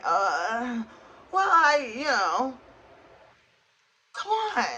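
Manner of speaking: high-pitched, mock-awkward stammer; flustered protest. Imagining being open with him, flustered. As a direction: act out squirming out of an awkward confession.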